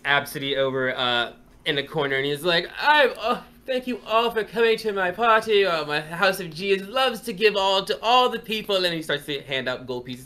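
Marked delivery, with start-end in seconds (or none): 2.8-8.8 high, loud, showy noble voice; audacious troll speech. Acted. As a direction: preen like a vain lord addressing his fans. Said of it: "troll speech".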